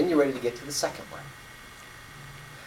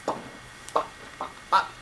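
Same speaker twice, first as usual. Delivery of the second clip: soft whispered popping noises; boy counting bubbles, whispering each pop; 7 dB quieter than usual. Retold or imitated.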